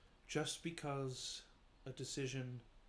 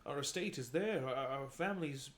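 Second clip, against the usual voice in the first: upset louder protest; 2 semitones higher, 7 dB louder than usual. Acted. Son upset at returning alone.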